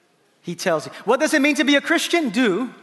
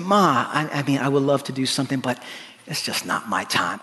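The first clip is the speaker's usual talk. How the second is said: very tender; 10 semitones lower, faster than usual. Shown as a demonstration. Son gently declining his mother's request, very tender. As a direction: let her down tenderly, voice dropped low. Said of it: "Maybe he said it very tenderly".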